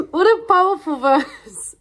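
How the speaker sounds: high, loud, laughing through the line; giggling through the phrase after a slip. Real, not acted. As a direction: laugh while getting the words out. Laughing after fumbling the word.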